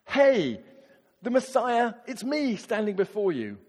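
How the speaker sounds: blurting out a bold claim; brash announcement. Pretend. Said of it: "if Jesus had said".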